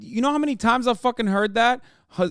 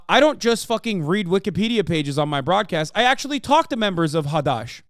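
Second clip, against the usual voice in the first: ordinary delivery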